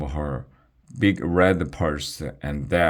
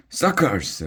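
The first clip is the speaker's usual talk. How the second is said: shrieked taunt; 9 semitones higher than usual. A narrator's reading. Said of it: "Bilge and Margot shrieked".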